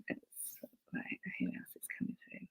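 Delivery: barely audible quick whisper; hushed thinking aloud; whispering to oneself. Real, not acted. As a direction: murmur it privately, very softly and fast. Murmuring to self while tuning in.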